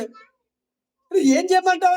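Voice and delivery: slow, high, strained plea; a starving brother giving in; pleading Esau. Retold or imitated. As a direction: Esau asking Jacob what he wants. Esau asks Jacob, retold.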